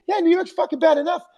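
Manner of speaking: high mock Burr riffing on tourists; Bill Burr roast. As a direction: roast the guy in the crowd, high and quick. Imitating Bill Burr roasting him.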